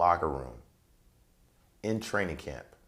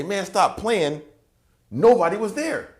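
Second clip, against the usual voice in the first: high, slow, startled call to an unseen friend; jumping as if someone teased him; startled exclaim; 14 semitones higher, 8 dB louder, slower than usual. Retold or imitated. Turns to the left and calls out, high and startled. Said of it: "as I looked to the left to say".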